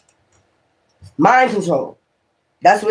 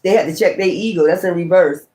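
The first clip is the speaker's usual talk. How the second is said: ordinary delivery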